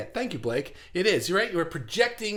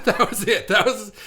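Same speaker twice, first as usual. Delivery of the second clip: laughing; 5 semitones higher, 5 dB louder than usual. Real, not acted. Laughing after the punchline.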